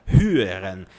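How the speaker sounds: "hören" with a clear initial h and rounded vowel; correct German pronunciation. Shown as a demonstration. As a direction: say "hören" properly, breathing the h before the rounded vowel. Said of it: "o verbo escutar".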